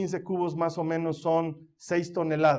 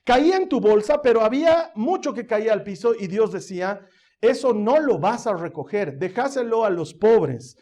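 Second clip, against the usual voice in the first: fervent preaching; 5 semitones higher, 6 dB louder than usual. Acted. Preaches with rising force.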